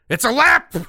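high, loud booming alien admiral; Admiral Ackbar voice. Retold or imitated. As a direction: bellow it like a fish-faced admiral alarm. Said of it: "in the Admiral Ackbar voice".

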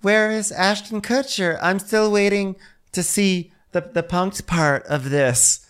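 high, drawn-out put-on voice; slow, hammed-up high imitation; slow mock demand. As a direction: stretch each word as if awaiting the prank reveal. Acting Whitney expecting a prank show.

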